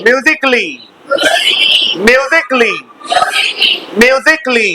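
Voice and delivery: musically as "myoo-zik-LEE", no vowel between k and l; silent A. Shown as a demonstration. Teacher drilling the class, musically with the "a" silent, "myoozik-lee". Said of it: "आ silent है".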